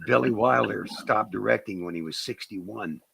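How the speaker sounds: lightly voiced low mumble; low, understated impression; Clint Eastwood. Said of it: "you can hardly ever hear him when he talks".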